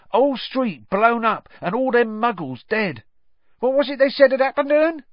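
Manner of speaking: high, loud, excitable gossip; chatty Stan. Acted. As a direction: relish the scandal, pitched right up.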